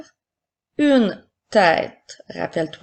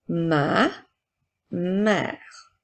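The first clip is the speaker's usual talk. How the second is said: word by word with pauses; careful dictation for pupils to write. Shown as a demonstration. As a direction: dictate slowly, each word set apart. Teacher dictating words to pupils.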